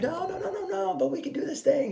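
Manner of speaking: very high mock-pleading squeal; pleading partner. Partner coming right back in, mock pleading. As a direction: wheedle in a silly falsetto.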